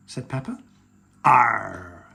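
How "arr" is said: loud, low pirate growl; little child playing pirate. A narrator's reading. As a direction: roar a drawn-out "arr" like a pirate. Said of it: "in his best pirate voice".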